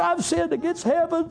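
a sinner's high broken plea; pleading son. Acted. Son's confession again.

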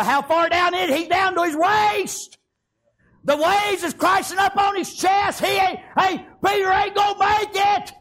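high, urgent cry from the boat; alarmed shouting. Acted. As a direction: call out the danger as a man goes under. Crew watching Peter sink, acted.

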